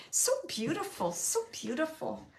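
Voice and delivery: ordinary talk, nothing marked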